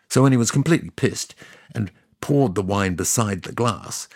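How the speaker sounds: ordinary talk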